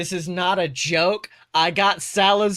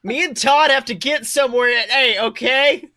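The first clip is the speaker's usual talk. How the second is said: higher, louder pushy dude voice; mock bro voice; 6 semitones higher, 6 dB louder than usual. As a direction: play an entitled guy making demands.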